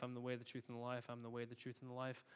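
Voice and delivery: quiet entranced repeating; hushed repetition. Retold or imitated. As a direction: repeat it softly like a trance. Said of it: "she keeps saying".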